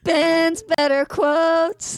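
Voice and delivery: slow, high jingle call; announcer jingle. Acted. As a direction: sing out the segment name. Jingle-style segment title.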